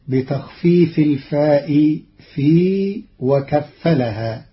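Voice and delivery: ordinary delivery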